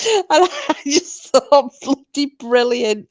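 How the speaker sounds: slow, words pushed through laughter; helpless giggle as you speak; laughing. Real, not acted. Amused by the tattoo story, laughing.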